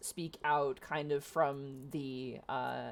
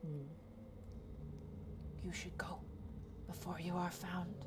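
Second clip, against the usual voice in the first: soft urgent warning; hushed warning; 12 dB quieter than usual. Acted. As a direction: giantess softly warning the small folk to leave. Giantess warns quietly.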